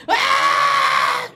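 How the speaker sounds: sudden piercing high shriek; retold scream. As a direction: scream like a possessed woman. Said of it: "sumisigaw na sila". (sigaw ng sinapian)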